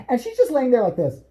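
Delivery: ordinary talk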